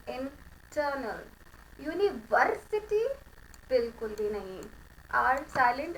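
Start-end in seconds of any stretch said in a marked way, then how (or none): 0.1-1.3 इंटरनल said slowly and softer, r dropped; इंटरनल drawn out with no r; demo pronunciation. Shown as a demonstration. Teacher to class, इंटरनल said slowly with the r dropped. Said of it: "तो हमारी pronunciation होगी".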